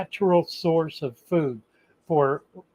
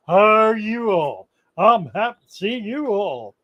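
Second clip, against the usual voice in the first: slow Southern drawl, stretched "well, well, well"; Southern accent; slower than usual. Acted. Pittman greets his friends in a Southern drawl. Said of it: "I get into a Southern thing".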